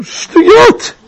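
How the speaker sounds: high, indignant exclamation, retold; mock angry outburst. Rabbi rejecting the MC's public thanks, mock indignant outburst. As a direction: fling the word out in mock outrage.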